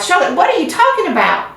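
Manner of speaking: high, alarmed pig voice; rising alarm, high; alarmed young pig. Acted. Wilbur in alarm.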